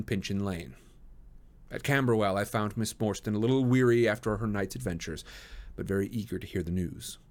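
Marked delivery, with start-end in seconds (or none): none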